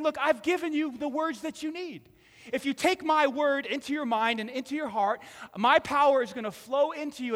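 loud preaching voice; full-force preaching. Real, not acted. Pastor preaching Peter's story loud to the congregation. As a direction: preach it out to the room.

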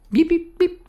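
very high little bird chirps; finch chirps. Retold or imitated. Telling about the escaped finch, very high chirping beeps. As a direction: chirp like a tiny zebra finch.

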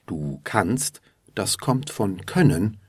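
ordinary delivery

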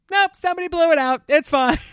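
high, fast, loud mock announcement; exasperated yell. Husband reporting back after needless drive, exasperated yell. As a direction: yelp it out in hammed-up exasperation.

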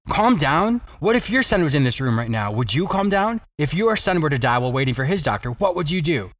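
ordinary talk

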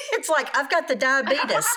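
high laugh into joking words. Real, not acted. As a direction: laugh through the joke. Laughs at own voice slip.

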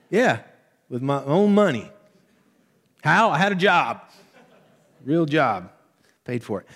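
cranky parent. Retold or imitated. Parent lecturing kids about buying own car, mock indignant. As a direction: boast with exaggerated indignation.

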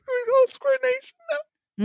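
high, squeaky words through laughter; laughing hard. Real, not acted. Host begging friends for the silly name, laughing hard. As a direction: barely get it out, cracking up. Cracking up while pleading with co-hosts.

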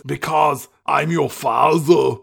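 put-on slow Austrian-accented action hero; mock-solemn reveal in an Austrian accent; Arnold Schwarzenegger. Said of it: "And Arnold says".

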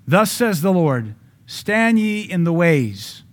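slow, lifted scripture proclamation; solemn scripture reading. Real, not acted. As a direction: read it like a pronouncement, slowly.

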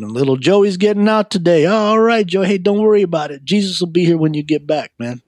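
high-pitched teasing mock send-off. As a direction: make fun of him with exaggerated, sing-along reassurance. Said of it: "I would make fun of 'em".

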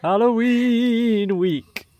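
slow, high ghostly wail; spooky voice. Acted. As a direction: draw it out like a ghost. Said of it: "we were supposed to do a spooky voice".

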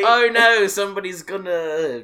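hammed-up outback alarm; Australian accent. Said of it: "Australian accent".

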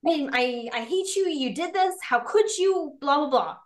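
acted angry blame trailing into blahs; accusing and attacking. Shown as a demonstration. Partner attacking their significant other with blame, accusing. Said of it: "one is accusing and attacking".

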